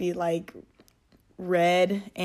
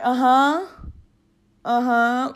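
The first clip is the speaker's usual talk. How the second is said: put-on loud, higher half-deaf old man's agreement; kindly old man; 5 semitones higher, 7 dB louder, slower than usual. Cow as an old man nodding along, kindly loud agreement. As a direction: agree amiably like you can't hear. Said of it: "like those type of old men".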